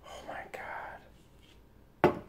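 breathless whisper, barely voiced; awed whisper. Real, not acted. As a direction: whisper it in disbelief. Overwhelmed by the card.

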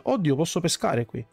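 ordinary talk, nothing marked

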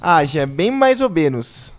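slow teasing drawl. Real, not acted. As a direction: stretch it out, teasing. Drawn-out teasing rating.

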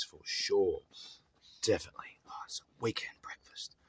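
soft unvoiced whisper; hushed whisper. Real, not acted. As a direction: whisper so you don't disturb him. Whispering while dog eats.

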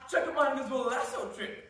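ordinary talk

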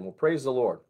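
normal talking voice

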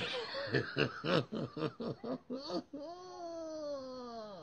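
high wheezing laugh fading out; helpless laugh. Real, not acted. Vlogger cracking up at the church story, wheezing laugh. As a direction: laugh till it hurts, high and breathless.

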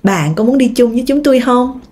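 normal talking voice

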